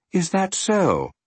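high, drawn-out offhand query; lightly voiced man who can't be bothered; slow, lilting, uninterested question; indifferent strange man. A narrator's reading.

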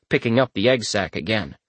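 ordinary delivery, nothing marked

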